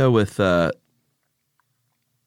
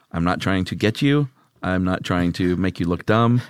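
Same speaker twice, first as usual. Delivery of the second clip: ordinary delivery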